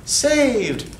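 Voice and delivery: high, breathless damsel cry; relieved damsel. Acted. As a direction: gasp it out as the rescued heroine, high-pitched. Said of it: "a young heroine".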